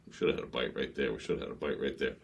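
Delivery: low, soft muttered self-talk; quietly puzzling it over to yourself; hushed worry. Retold or imitated. Captain retelling a missed bite, hushed worry.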